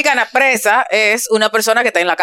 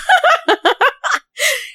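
high giggling laugh. Real, not acted. Laughing at the joke.

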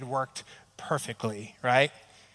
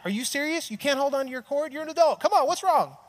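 much higher, hammed-up indignant rant; mock indignation; 9 semitones higher, faster than usual. Husband retelling his indignant reaction to his wife losing cords, mock-exasperated. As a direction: play the exasperated husband, pitched way up. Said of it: "I'm so mad".

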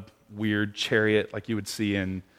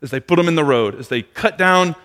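ordinary talk

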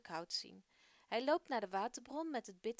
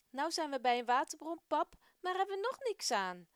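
mildly annoyed, raised complaint to his father; a bit cross; 6 semitones higher, 7 dB louder than usual. Acted. Gerson complains to his father at the bitter well, a bit cross. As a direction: grumble at pap, a little cross. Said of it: "zegt hij een beetje boos".

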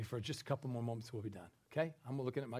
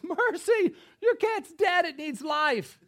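much higher, louder mock-disbelief; incredulous retort; 13 dB louder, slower than usual. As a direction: toss it back in disbelief. BJ retorts to the lady.

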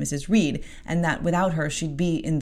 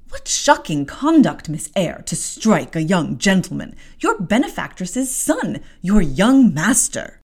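louder, slow reproach; indignant scolding; 2 semitones higher, 6 dB louder than usual. Acted. Maid to Jane after the strike, indignant scolding. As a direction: drag out the rebuke.

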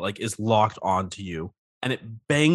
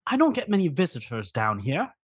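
lightly voiced lonely crab; a hint of a needy crab; crab character voice; 6 semitones higher than usual.

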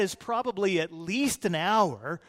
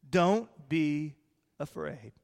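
gentle reassurance; 5 semitones lower than usual. Acted. Gentle repeat of Jesus' words.

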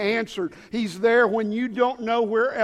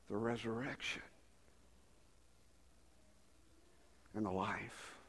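hushed preaching; 8 semitones lower, 13 dB quieter than usual. Real, not acted. Preacher reminds the forgetful of their hope, hushed. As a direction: let each phrase sink in quietly.

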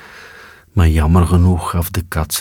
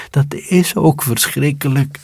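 mice voice; 9 semitones higher than usual. A narrator's reading. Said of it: "zeiden de muizen".

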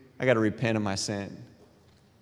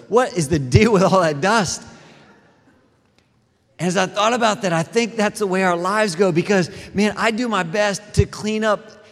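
high, loud, fired-up preacher voice; full-force preaching; 9 semitones higher, 5 dB louder than usual. Acted. Pastor rousing the congregation about hidden dust, loud preaching. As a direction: preach it out at full volume, building.

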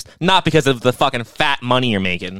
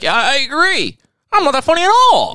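high, louder agreeable sarcastic voice; mock Joe Rogan; 13 semitones higher, 4 dB louder than usual. Host mocking a guest, high and sarcastic. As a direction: squeak it out like a pushover host. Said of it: "Be like".

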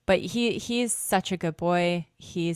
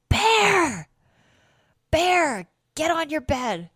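high, loud mock-angry scolding, much higher than her talk; pretend angry voice; 12 semitones higher, 8 dB louder, slower than usual. Dog owner scolding her dog Bear in pretend anger. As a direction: bark the dog's name sharply, then order him to bed. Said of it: "when I did my pretend angry voice".

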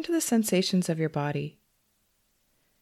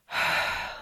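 long, audible breath out; demonstrated exhale. Shown as a demonstration. Meditation teacher showing listeners how to exhale, releasing sigh.

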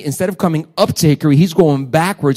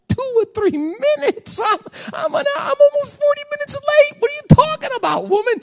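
much higher, strained wailing outburst; exasperated cry. Retold or imitated. Pastor forty minutes late wailing at his wife, high anguished cry. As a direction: cry out in comic despair.

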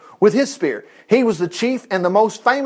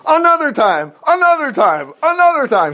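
excited build-up; 8 semitones higher, 3 dB louder than usual. Real, not acted. Repeated build-up exclamation.

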